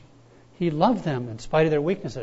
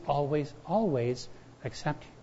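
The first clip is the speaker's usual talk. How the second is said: soft repetition; 7 dB quieter than usual. Acted. Repeated always, soft.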